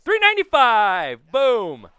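triumphant bellow; excited shout. Real, not acted. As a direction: belt it out like a stadium announcer. Home run call shouted.

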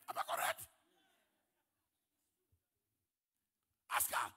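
shouted challenge to the crowd; loud call-out. Real, not acted. Pastor calling loudly to the congregation, loud. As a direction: throw it out loudly to everyone.

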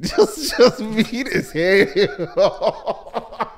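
high, breaking into a long laugh; can barely get it out for laughing; helpless laughter swallowing the words. Real, not acted.

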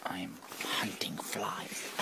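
hushed hunting whisper. Real, not acted. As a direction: whisper so the fly won't hear. Whispering while hunting a fly.

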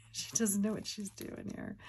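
normal talking voice

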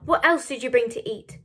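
loud, brusque question; shouted demand. A narrator's reading. Hungry man asking a girl for food, shouted demand. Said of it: "shouted the bold man".

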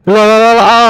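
high, loud taunting yell; battle roar. Real, not acted. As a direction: roar them over to you. Excited taunt calling enemy.

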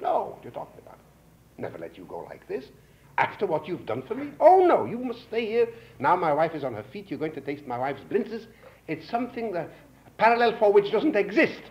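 mock-insistent host protesting, lightly voiced. Host refusing to let his guest leave, insistent.

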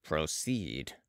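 low, soft solemn command; a hint of the prophet, deep and quiet; inspired prophet. A narrator's reading. Balsamo repeats his order to the surgeon, quietly prophetic. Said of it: "with the manner of an inspired prophet".

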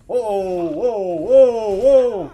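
drawn-out alarmed howls; panicked wails. Real, not acted. Under attack in game.

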